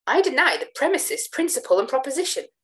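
high, loud protest; high, loud indignant protest; indignant outcry. A narrator's reading. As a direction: cry out in outrage. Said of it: "cried I, springing up and smiting the table".

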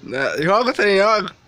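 loud, impatient urging. Real, not acted. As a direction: shout at him to pour it. Urging friend loudly.

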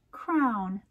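plain reading voice

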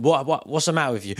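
scolding parent. Retold or imitated. Parent scolding crying child.

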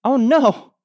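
sudden high, loud gasp of dismay; alarmed cry. Acted. Darlene hears Gloria Burgle may vanish, alarmed cry. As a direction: blurt it out in alarm.

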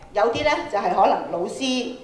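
normal talking voice

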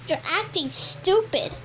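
usual speaking voice

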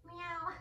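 a high playful meow; cat meow. Shown as a demonstration. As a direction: meow like a cat to show the kids.

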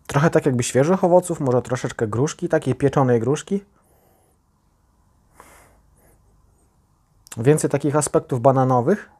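talking into tasting glass; slightly muffled near glass; speaking with glass at nose. Real, not acted. Seen 1: Small tasting glass held at nose and mouth throughout the speech.

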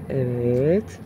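ordinary delivery

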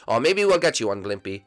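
normal talking voice

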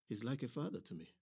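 plain reading voice

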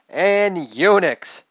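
ordinary talk

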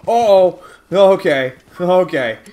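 loud, high-pitched alarm; startled shout, pitch jumping up. Real, not acted. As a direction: blurt it loudly as danger suddenly appears. Sudden danger in the game.

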